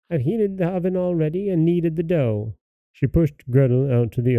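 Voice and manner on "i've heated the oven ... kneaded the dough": high-pitched witch voice; old dame. A narrator's reading. Witch telling Gretel about the baking, high old-dame voice. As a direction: announce it in a high old-woman voice. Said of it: "said the old dame".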